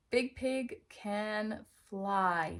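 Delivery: very slow, word by word; slow reading. Shown as a demonstration. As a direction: drag out each word. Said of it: "maybe they can sound it out".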